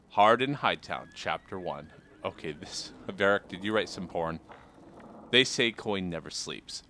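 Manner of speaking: reading a pulpy crime novel aloud, measured; story reading. Acted. Reads Varric's novel aloud.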